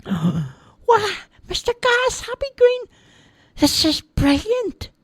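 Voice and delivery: put-on high childish voice, thrilled; wide-eyed delight; amazed boy. Roger flying over the village, high and amazed.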